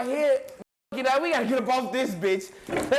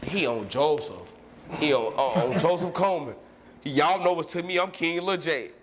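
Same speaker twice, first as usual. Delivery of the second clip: mock deep voice; 9 semitones lower than usual. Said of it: "my guys mimic me".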